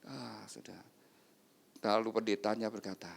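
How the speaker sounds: ordinary talk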